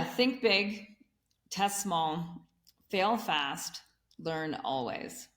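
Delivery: spaced out phrase by phrase, pausing after each; deliberate recap with clear pauses between phrases. Shown as a demonstration. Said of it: "say that again slower".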